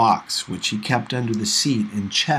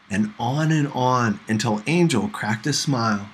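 ordinary delivery, nothing marked

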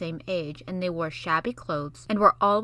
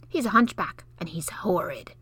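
whispered secret gossip; gossipy whisper. Acted. Basil whispering gossip to Mary, whisper. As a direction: hush it like a secret.

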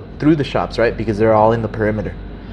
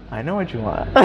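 understated insider murmur; knowing undertone; 8 dB quieter than usual. Retold or imitated. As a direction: say it quietly, under your breath. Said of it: "But he says it".

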